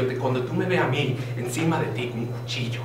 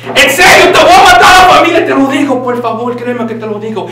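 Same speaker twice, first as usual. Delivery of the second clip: much higher and louder shrieking threat; screaming threat; 10 semitones higher, 12 dB louder than usual. Pretend. Fake teacher screaming threats as goodbye. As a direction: scream it, then let it fall away. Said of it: "The way to say goodbye in Spanish is".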